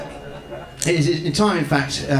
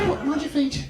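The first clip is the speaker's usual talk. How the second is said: pigeon; 7 semitones higher, 4 dB quieter than usual. Pretend. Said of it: "my impression of a pigeon hoovering".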